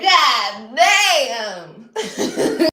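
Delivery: loud laughing exclamation. Retold or imitated. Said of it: "I'm like".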